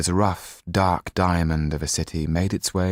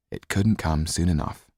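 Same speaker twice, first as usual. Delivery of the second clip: plain reading voice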